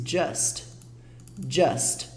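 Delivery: "just" said djast with a dark u; English just. Shown as a demonstration. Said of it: "olha a pronúncia".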